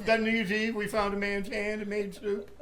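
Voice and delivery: giggling bum character bit; homeless guy character. Acted. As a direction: ham up a rambling homeless character through laughter. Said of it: "We would do Higher and Hinty".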